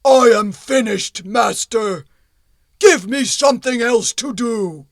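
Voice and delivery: loud, heavy stone golem. Acted. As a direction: boom it out like a stone giant. Golem demands work.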